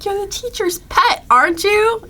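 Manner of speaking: mock Patricia's taunting voice; stupid taunting voice. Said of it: "that stupid voice that she makes".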